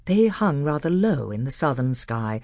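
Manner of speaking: ordinary delivery, nothing marked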